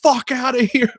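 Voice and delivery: high, loud mock yell; mock screaming. Boss catching the men on the ladder, mock yelling. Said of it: "they just started screaming".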